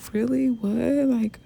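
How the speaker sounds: high, eager mock surprise; imitating excited manager. Manager hearing the news on the phone, excited disbelief. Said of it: "She literally got so excited".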